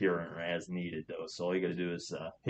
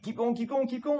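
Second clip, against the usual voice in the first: urgent encouragement. Real, not acted. Coaching patient through inhaler breath.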